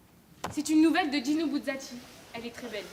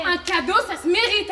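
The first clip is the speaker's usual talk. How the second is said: loud, raised comeback; heated retort; 4 semitones higher, 8 dB louder than usual. Acted. Answering Alexia's complaint about gifts, heated retort. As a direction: throw the words back forcefully. Acted quarrel, answering back.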